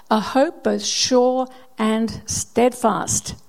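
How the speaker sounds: very slow and drawn out; slow emphasis. Acted. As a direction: savour every word slowly. Preacher dwelling on scripture phrase.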